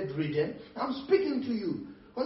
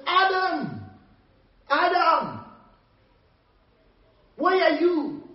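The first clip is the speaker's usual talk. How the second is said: loud, high call as God to Adam; 6 semitones higher, 6 dB louder, slower than usual. Acted. Preacher as God searching for Adam, calling out loudly. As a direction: call out for someone hidden, raised and ringing. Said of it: "called out to Adam".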